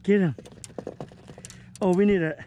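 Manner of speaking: usual speaking voice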